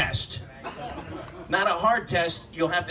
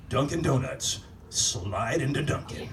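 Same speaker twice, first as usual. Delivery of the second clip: mock ad tagline; 4 semitones lower, 4 dB quieter than usual. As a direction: purr it like a suggestive commercial. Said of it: "The new tagline for Dunkin' Donuts".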